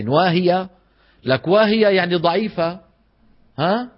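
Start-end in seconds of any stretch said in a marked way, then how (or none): 0.1-2.8 "واهية" said as the wrong word, loud and raised; wrong reading shown. Shown as a demonstration. Teacher mocks students misreading "واهية". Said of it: "لا تقولوا واهية".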